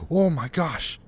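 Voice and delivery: high, loud burst of fright; startled. Real, not acted. Player just jumped at a noise in a horror game, startled. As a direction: blurt it out, badly startled. Reacting to a jump scare.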